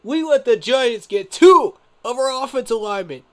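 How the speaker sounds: very loud, high rant peaking on Two; furious outburst. Real, not acted. Fan ranting at his team's front office, furious yelling. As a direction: yell it at the management.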